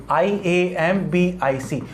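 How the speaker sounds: slow, letter-by-letter spelling; measured classroom spelling, each letter separate. Shown as a demonstration. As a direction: spell it slowly for the class, one letter at a time. Teacher spells the term out.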